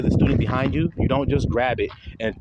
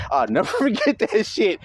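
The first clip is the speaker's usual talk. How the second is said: higher, breaking into a laugh; laughing recollection; 7 semitones higher than usual. Real, not acted. Telling viewers of classmate's blunder, laughing. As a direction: say it through amusement.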